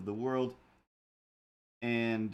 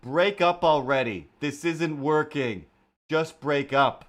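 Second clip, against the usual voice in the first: loud, emphatic mock shout; re-enacted yell; 3 semitones higher, 8 dB louder, slower than usual. Fed-up neighbour yelling out the window at a bickering couple, re-enacted shout. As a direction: holler it like advice to the neighbours. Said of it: "I yelled out the window".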